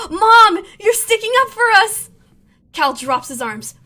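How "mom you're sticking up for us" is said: high, loud delighted outburst; excited. Acted. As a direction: burst out thrilled that mom helps. Delighted surprise at mom.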